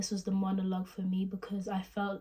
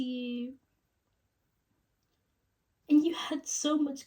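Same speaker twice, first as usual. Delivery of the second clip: halting, higher tearful break-up confession; Rachel; 4 semitones higher than usual. Acted. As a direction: ache through long pauses, voice pitched up near tears. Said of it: "sad tone".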